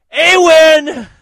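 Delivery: screamed high and loud; shouted name. Acted. Host shouting out a listener, high scream.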